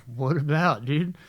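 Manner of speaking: retold stifled laugh; holding back laughter. Prankster answering brother, trying not to laugh. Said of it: "trying not to laugh".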